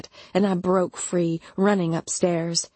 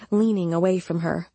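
ordinary delivery

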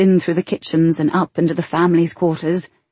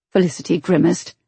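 usual speaking voice

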